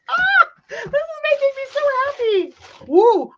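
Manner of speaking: high, fast, loud cry of joy; happy shout. Real, not acted. Unboxing gifts from a viewer, fast high joyful shout. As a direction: shout out how happy it makes you.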